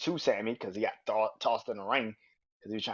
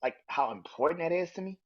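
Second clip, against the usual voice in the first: pleading; 8 semitones higher, 3 dB louder than usual. Retold or imitated. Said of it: "He said".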